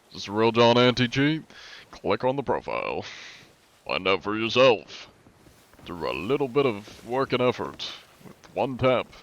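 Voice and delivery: mock ad pitch, upbeat and a little high; infomercial. Streamer joking to chat about anti-cheat, mock infomercial pitch. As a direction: sell it like a TV salesman. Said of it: "Feel like an infomercial".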